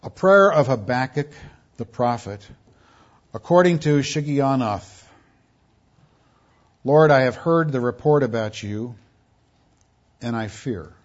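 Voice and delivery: solemn, measured reading of the prophet's prayer; scripture reading. Acted. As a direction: read it out like Holy Writ before the congregation. Reads scripture aloud solemnly.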